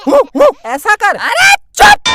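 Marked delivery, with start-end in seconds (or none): 1.2-2.1 high, loud witch shut-up; witch snap. Acted. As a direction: cut them off like a witch. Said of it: "मैं हूँ चुड़ैल". (चुड़ैल की ऊँची डाँट)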